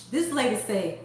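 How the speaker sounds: ordinary delivery, nothing marked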